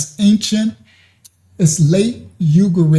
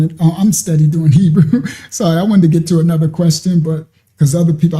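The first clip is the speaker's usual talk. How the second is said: ordinary talk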